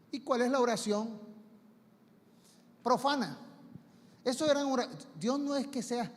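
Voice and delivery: normal talking voice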